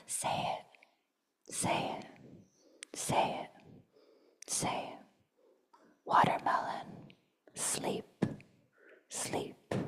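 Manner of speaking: very soft whispered repetitions, long gaps; faint whispers. Acted. Poet lulling listeners, faint whisper.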